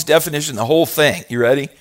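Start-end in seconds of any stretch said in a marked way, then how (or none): none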